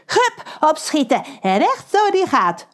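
high eager urging; cheering on. Acted. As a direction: egg the crew on gleefully. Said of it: "moedigt Pedro hen aan". (Pedro moedigt opgewonden aan)

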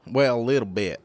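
hammed-up Southern accent. Shown as a demonstration. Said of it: "really terrible over the top".

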